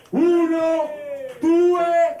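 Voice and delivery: slow call, then shouted count; building countdown shout. Real, not acted. Host counting down for crowd.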